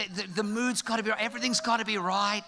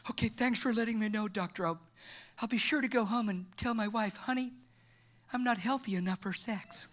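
meek, deflated patient; 6 dB quieter than usual. Acted. Acted patient taking bad news.

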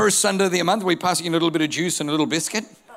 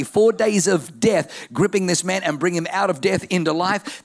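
ordinary talk

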